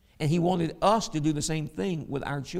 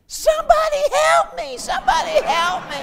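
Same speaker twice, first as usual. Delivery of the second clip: mock toddler scream, much higher and louder; hammed-up child screaming for help; 11 dB louder than usual. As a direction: shriek it like a kid being carried out. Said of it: "And he's screaming".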